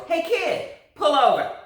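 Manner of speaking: high, loud officer's yell; police yell. A narrator's reading. Police officer yelling at speeding Loretta, loud. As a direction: bark it out the car window.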